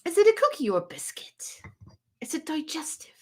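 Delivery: unvoiced, hushed question; whisper. Real, not acted. Asking the chat about biscuits in a whisper. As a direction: whisper it like a secret.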